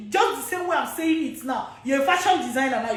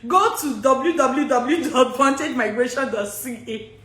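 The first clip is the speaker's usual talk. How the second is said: normal talking voice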